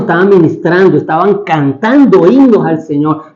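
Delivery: ordinary delivery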